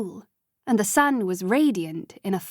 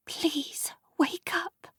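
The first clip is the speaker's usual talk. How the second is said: high, soft plea; tearful hushed plea; pleading; 8 semitones higher, 7 dB quieter, much slower than usual. A narrator's reading. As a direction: beg him softly to wake. Sophie pleading at bedside.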